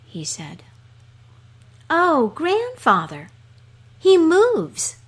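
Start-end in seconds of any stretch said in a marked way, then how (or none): 1.9-5.0 rising to a very high, loud squeal of joy; a dog thrilled the man is alive; high delighted little dog shout; delighted cry. Acted. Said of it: "cried the dog in delight".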